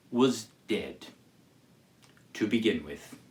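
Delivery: usual speaking voice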